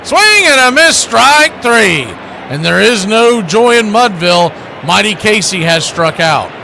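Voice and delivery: loud, soaring play-by-play call of the final out; booming ballpark strikeout call; big strikeout call. Acted. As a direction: call it like the whole crowd is listening. Dramatic strikeout call, Casey.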